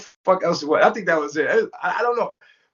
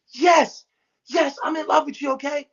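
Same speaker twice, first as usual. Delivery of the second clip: raised, exasperated mock outburst; snapping; 6 semitones higher than usual. Velvet all of a sudden confessing love, snapping. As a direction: imitate her snapping out a confession, pitched up. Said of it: "she starts snapping".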